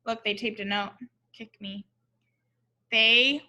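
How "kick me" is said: ordinary delivery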